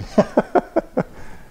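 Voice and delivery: breathy laugh. Real, not acted. Laughs at talking through mask.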